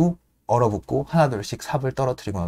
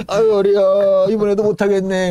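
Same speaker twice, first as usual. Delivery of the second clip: put-on higher, louder groan of an aching old man; old Paddy groaning he can't go on, louder; groaning old stoker; 7 semitones higher, 5 dB louder than usual. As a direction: moan about your head.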